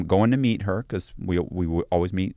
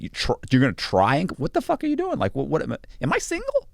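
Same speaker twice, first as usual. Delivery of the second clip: rising, exasperated disbelief, pitched much higher; incredulous; 7 semitones higher than usual. Retold or imitated. Retelling his outrage at her excuse, incredulous. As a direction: voice shooting up in disbelief.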